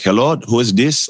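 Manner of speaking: bad Nigerian accent. Father answering an unknown caller, in a badly imitated Nigerian accent. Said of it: "Like, heavy Nigerian accent. And I got a, I'm bad Nigerian accent".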